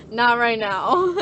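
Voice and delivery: slow words through laughter; laughing, drawn out. Retold or imitated. To her parents, laughing and slow. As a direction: laugh the refusal out slowly.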